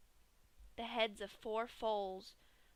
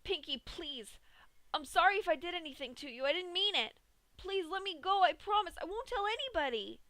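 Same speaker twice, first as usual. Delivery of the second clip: high, fast, frantic begging; desperate pleading; 8 semitones higher, 4 dB louder than usual. A narrator's reading. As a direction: beg for your life. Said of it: "Dash pleaded with the smiling pony".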